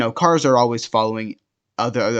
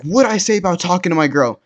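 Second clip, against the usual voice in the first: retold angry challenge, louder and higher; angry confrontation; 6 semitones higher, 4 dB louder than usual. Jealous boyfriend turns friend around over his girl, angry challenge.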